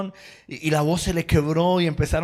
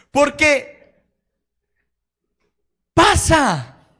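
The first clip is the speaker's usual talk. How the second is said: full-force preaching; 10 semitones higher, 5 dB louder than usual. Acted. Pastor urging the congregation to cry out to God, fervent loud preaching. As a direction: preach it out, rising to a fervent peak.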